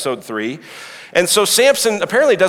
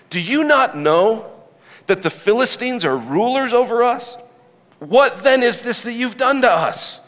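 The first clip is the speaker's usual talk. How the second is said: exasperated accusers; 8 semitones higher than usual. Acted. Men of Judah confront Samson.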